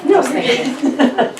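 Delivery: slow, giggling refusal; can't hold a straight face; laughing. Real, not acted. Actress laughs mid-line.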